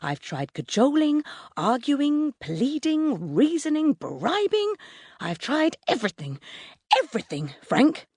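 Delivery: exasperated list climbing high, then dropping; lashing back. A narrator's reading. Mum listing what she tried with her son, exasperated. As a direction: reel off each attempt, at your wits' end. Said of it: "Mum lashes back".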